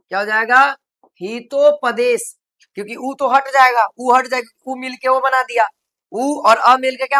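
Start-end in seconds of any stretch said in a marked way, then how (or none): 1.2-2.3 slow demo. Shown as a demonstration.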